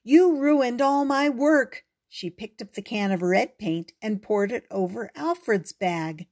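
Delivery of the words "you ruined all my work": loud, higher, slow indignant cry; angry cry. Acted. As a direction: yell at him for ruining it. Susie furious after paint spill.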